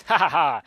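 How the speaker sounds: loud imitated jeering "ha ha ha"; hammed-up bully's guffaw; spelt-out mock laughter; mocking fake laugh.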